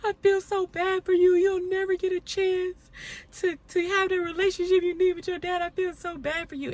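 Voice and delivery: high, loud wailing mock; imitating her mother wailing with pity; imitating hysterical mom. Retelling her hysterical mom wailing, high and loud. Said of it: "she's like".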